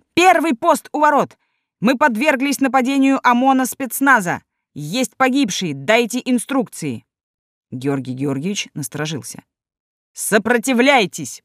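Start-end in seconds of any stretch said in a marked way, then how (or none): 0.1-7.1 high, urgent shout; alarmed guard yelling orders. A narrator's reading. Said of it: "там прокричали". 10.1-11.4 commanding. A narrator's reading. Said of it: "приказал он".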